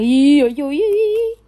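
loud wordless yodel climbing very high; weird sound. Real, not acted. Working nervously on the craft, loud weird rising squeal. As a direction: let a silly noise swoop upward, loud. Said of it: "I just made a weird sound".

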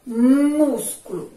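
Músculo slow and loud on MÚS; stressed reading. Shown as a demonstration. Teacher to pupils, modelling the strong syllable. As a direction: drag the word, punch MÚS. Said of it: "Vamos à primeira".

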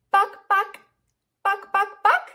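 high, short clucks; quick bursts of BUCK; chicken clucks. Shown as a demonstration. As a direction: imitate a hen clucking. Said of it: "A chicken says".

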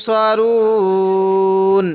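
সরুন stretched with a long held ending; pause-form reading. Shown as a demonstration. Teacher showing students how to stop on সরুন, drawn out. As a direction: stop on সরুন, drawing out the final vowel. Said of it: "ওয়াকফ করি তাহলে কিভাবে পড়ব".

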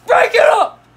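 loud commanding yell; shouting. Acted. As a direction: stop the fight with a shout. Teacher stopping a fight.

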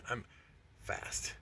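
breathy whisper, no voice at all; whispered brag. Shown as a demonstration. Bragging to viewers about his speed: whispered aside. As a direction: drop to a whisper to sneak in the boast.

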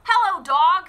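high, bright greeting as the duck; cheerful duck. Acted. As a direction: call out hello as a friendly duck. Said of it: "said Duck".

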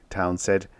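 plain reading voice

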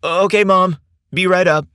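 high, loud, sweet call back; sweet reply. A narrator's reading. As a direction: answer Mom sweetly, calling up to her. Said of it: "Sam answered sweetly".

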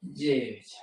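normal talking voice

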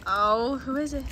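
ordinary talk

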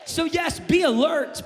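shouted, high triumphant proclamation, slowing down; preaching at full force. Acted. Pastor shouting victory over death to the church, full-force preaching.

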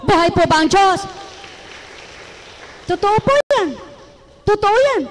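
fiery preacher call-and-response; preaching at full force. Acted. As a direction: rouse the congregation with ringing questions. Preacher rallies the congregation.

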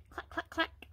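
crisp whispered clack clack clack; high dry beak clicks. A narrator's reading. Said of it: "snapped his beak".

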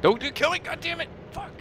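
high-pitched cry of frustration; frustrated yell. Real, not acted. Player dying to an enemy, high frustrated yell. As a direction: yelp as you get shot down. Getting killed in game.

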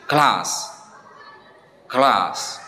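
class with a long back ah; teacher demo of British class. Shown as a demonstration. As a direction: say class as klahs. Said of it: "British English ini dibaca class".

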